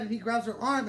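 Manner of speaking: ordinary delivery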